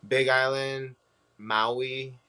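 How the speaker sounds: usual speaking voice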